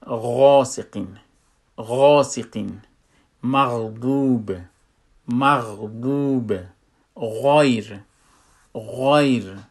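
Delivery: loud, slow "غاسقین" and "مغضوب" with a heavy upper-throat gh; thick ghain. Shown as a demonstration. Teacher giving examples, thick ghain in "غاسقین", "غیر". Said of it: "او یک غلظت داره".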